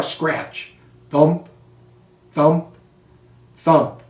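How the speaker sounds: plain reading voice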